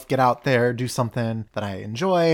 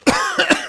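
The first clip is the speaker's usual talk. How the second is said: sudden hacking cough; choking cough; 5 dB louder than usual. Real, not acted. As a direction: cough hard, caught on food. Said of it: "I just started choking".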